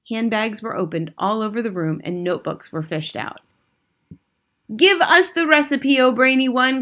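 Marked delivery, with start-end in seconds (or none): 4.8-6.8 high, loud eager demand; impatient cry. A narrator's reading. Witch audience begging for the recipe, impatient cry. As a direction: clamour in a high crowd voice. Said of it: "cried the audience impatiently".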